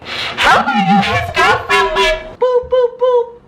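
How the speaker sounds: high, loud mock woman's voice; the other woman. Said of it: "And she was like".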